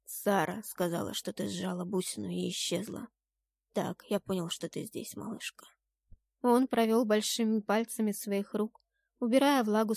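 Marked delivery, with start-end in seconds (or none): 0.0-5.7 soft, slightly lowered voice fading almost to a whisper; hushed, intimate reassurance; tender lover. A narrator's reading. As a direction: explain gently to your little one. Jordan answers softly.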